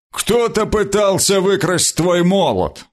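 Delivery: lightly voiced warrior telling news; stern warrior voice. A narrator's reading. Bernard voiced in read story.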